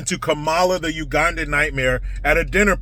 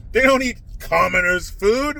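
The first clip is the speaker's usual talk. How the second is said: ordinary talk, nothing marked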